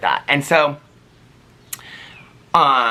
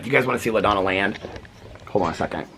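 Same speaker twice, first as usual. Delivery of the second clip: ordinary talk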